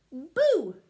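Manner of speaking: sudden loud boo; jump-scare the leaf. Acted. [name] scaring leaves.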